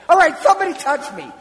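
loud, high, fast mock demand; playful loud call. Preacher pretending to be Jesus, loud playful shout. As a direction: shout it out to the crowd as a joke. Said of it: "I would love to do that".

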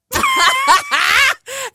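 high delighted laugh; shrieking laugh. Real, not acted. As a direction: burst into giggles. Big laugh.

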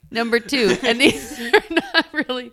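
laughing through the words; can't keep a straight face. Real, not acted. Reading questions, breaks into laughter.

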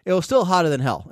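ordinary talk

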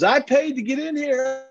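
very high, strained mock yell; screaming fan. Tech fan screaming at losing players, defiant. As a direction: imitate an obnoxious heckler hollering from the stands. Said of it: "this Tech fan is screaming at them".

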